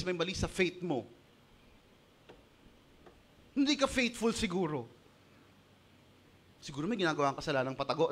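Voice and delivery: ordinary talk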